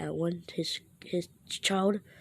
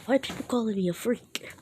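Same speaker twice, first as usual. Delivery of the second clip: louder, higher acted frustration; upset outburst; 4 semitones higher, 4 dB louder than usual. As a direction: vent it, hammering the repeated word. Upset Naruto alone at home.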